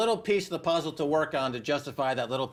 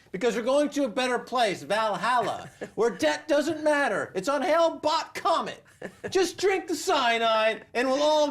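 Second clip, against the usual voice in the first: Jim Jones; 7 semitones higher than usual. Retold or imitated. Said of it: "This is like Jim Jones saying".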